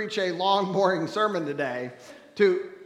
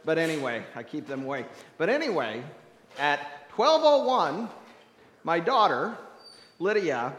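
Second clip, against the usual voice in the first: usual speaking voice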